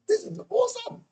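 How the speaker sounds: high, amazed inner voice; delighted inner exclamation; excited thought. Retold or imitated. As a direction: squeal it to yourself. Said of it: "I'm thinking".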